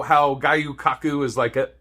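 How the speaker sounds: normal talking voice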